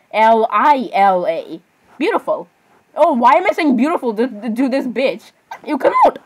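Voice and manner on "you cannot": very high, squeaky "You cannot"; squealed catchphrase. Acted. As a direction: squeal it in mock disbelief.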